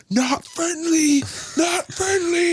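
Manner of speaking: very high, drawn-out cry; imitated video warning. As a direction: mimic a viral clip with a high, stretched call. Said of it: "the sound in the background's like".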